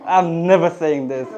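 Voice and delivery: high, slightly slowed mock squeamish protest; squeamish refusal. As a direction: imitate students cringing away from the word. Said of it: "women students were like".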